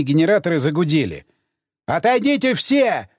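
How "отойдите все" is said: higher, drawn-out warning call; restrained shout. A narrator's reading. Scientist warning everyone off, a restrained shout. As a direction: stretch the warning out, without real volume. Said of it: "закричал учёный".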